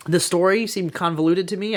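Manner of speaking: ordinary talk, nothing marked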